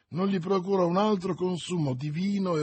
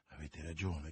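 low, soft, subdued reply; quiet concession; 10 semitones lower, 13 dB quieter than usual. A narrator's reading. The stranger gives in to Milady's warning, quiet concession. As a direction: concede it softly, voice dropped.

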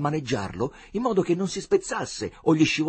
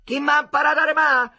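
high, loud indignant comeback; retort; 14 semitones higher, 9 dB louder than usual. Acted. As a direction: throw it back at them. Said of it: "ribatté Agnolo". (ribattuta indignata)

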